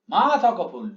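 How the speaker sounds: long "মা", "ফুল" as one closed syllable; metrical recitation. Shown as a demonstration. Teacher reciting for the beat count, "মা" long-drawn. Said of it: "ফুলটা আবার দুই মাত্রা একসাথে".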